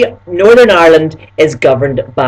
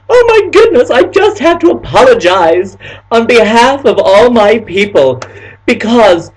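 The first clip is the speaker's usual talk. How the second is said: dramatic stranger; 7 semitones higher than usual. Retold or imitated. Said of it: "And she went".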